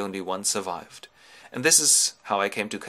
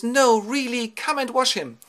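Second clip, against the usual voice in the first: high-pitched, excited woman's voice; delighted wife; 14 semitones higher, 5 dB louder than usual. Acted. Penelope hearing news of Ulysses, high delighted voice. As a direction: exclaim in a high wifely voice. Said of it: "and she goes".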